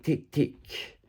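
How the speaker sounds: a soft, hissed whisper of take, take; whispered mockery of the greedy. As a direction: breathe the words out, mocking their greed. Mocking greedy people's taking.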